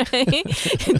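laughing. Real, not acted. Laughing agreement.

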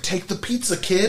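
high-pitched mock order; hammed-up dismissive command; imitated brusque teacher. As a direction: brush the kid off in a put-on voice. Teacher brushes off kid, retold.

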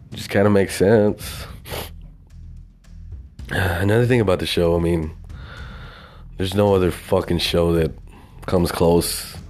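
normal talking voice

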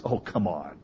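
low, soft, scornful "Oh, come on"; scoffing disbelief. Retold or imitated. Sarah's doubting laugh, low scornful scoff. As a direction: say "Oh, come on" like you don't buy it. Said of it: "her laugh was".

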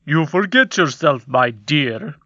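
lightly voiced stern king; reproving king voice. A narrator's reading. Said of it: "said the king".

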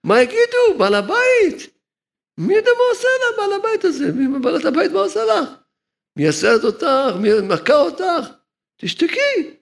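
high, shrill-edged mock mistress of the house; scolding mistress. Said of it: "בעלת הבית שלה אמרה לה".

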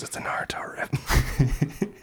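hushed whisper into the mic. Real, not acted. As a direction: whisper it like a secret. Whispered hot take.

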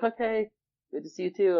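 retold reply, pitched high; high, polite imitation of his younger self; putting on okay. Holding back tears from friends, retold in high polite voice. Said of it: "so I was just like".

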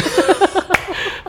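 loud, high cackling burst; hearty laugh. Real, not acted. Guest reacting to "salah siapa" about the pregnancy, hearty laugh. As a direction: crack up for real.

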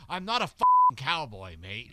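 indignant Aussie snap at being called a cowboy; mock Australian rocker, offended; Australian accent. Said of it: "Can't do an Australian accent".

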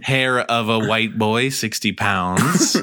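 slow, drawn out like a witch's recipe; slow spell recipe. Pretend. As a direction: read the ingredients slowly and gravely.

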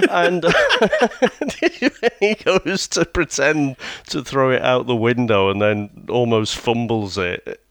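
ordinary delivery

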